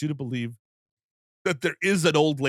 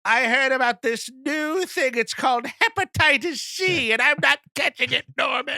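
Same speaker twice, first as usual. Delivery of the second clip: Norma Bates; 11 semitones higher, 3 dB louder than usual. Pretend. Mother warning her son of disease, comic scolding old lady. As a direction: fuss loudly like a scandalized mother. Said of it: "you just hear over the P A".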